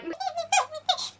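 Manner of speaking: very high squealing giggle; overwhelmed squeal. Retold or imitated. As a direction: squeak wordlessly at the ring. Retells reaction on seeing the ring.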